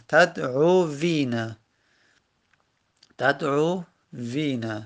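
تدعوینا drawn out slowly in separate syllables; syllable-by-syllable demo. Shown as a demonstration. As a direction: stretch تدعوینا, dwelling on the "vii" with its kasra. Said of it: "واو کسره روشه".

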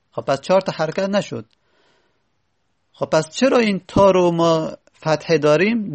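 ordinary talk, nothing marked

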